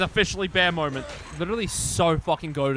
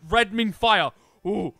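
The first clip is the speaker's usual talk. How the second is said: a touch of playful caveman voice; mock caveman; 4 semitones higher, 4 dB louder than usual. Streamer explaining fire damage, lightly voiced caveman. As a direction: lightly play a simple caveman. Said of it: "Me caveman".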